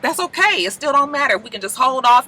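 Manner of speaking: high, fake-sweet voice; mock sugary Desna; fake sweet stalling. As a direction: coax him sweetly.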